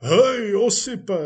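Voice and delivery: loud, high hail; calling out. Acted. Police chief hails his old friend Osip, calling out. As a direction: shout to get a friend's attention.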